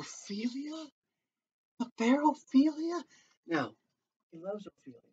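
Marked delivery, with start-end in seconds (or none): 0.0-3.0 shocked Hamlet. Acted. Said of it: "Hamlet's like".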